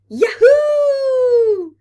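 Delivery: high joyful whoop from the swings; gleeful shout. Acted. Little planets calling from the swings, gleeful shout. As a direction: shriek it out with glee.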